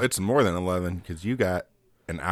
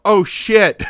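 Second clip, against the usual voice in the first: high, loud imitation of a startled yell; mock yell, as if from another room; retold yell; 10 semitones higher, 8 dB louder than usual. Retelling the wife's outburst from the other room, mock yell. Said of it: "hear Brittany from the other room yell".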